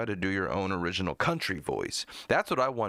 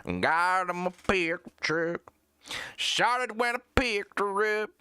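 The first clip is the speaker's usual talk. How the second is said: high, twangy mock country singer; generic country singer; 10 semitones higher, 3 dB louder, slower than usual. Streamer mocking country singers who sound alike, high drawn-out twang. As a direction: draw out a put-on country twang. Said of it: "exactly like, uh, the last singer".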